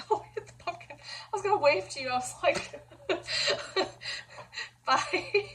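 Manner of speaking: giggling through the words; laughing. Real, not acted. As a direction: laugh breathlessly while talking. Laughing after hitting pumpkin.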